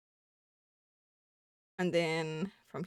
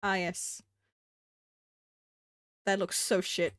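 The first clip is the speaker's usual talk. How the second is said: normal talking voice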